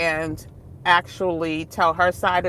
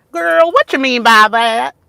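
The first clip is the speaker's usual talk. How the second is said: put-on louder, higher country accent; 6 semitones higher, 9 dB louder than usual. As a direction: slip into her home country accent. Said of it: "I sometimes go into my country, uh, accent".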